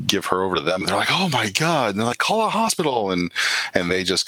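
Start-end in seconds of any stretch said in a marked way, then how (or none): 1.1-1.9 higher-pitched alarmed gasp of words; alarmed bystander. Acted. Bystanders handed an injured girl, alarmed. 2.2-3.1 high-pitched urgent bystander; alarmed bystander. Acted. Bystanders seeing the injured girl, urgent alarm.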